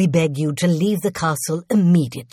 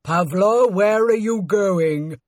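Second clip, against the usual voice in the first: slow, projected call after someone fleeing; indignant servant shouting after a deserter; shouted challenge; 2 semitones higher, 4 dB louder, slower than usual. A narrator's reading. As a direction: call out in alarmed demand. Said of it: "shouted Luton".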